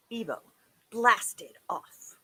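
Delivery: usual speaking voice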